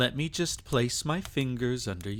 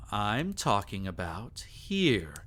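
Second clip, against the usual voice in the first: normal talking voice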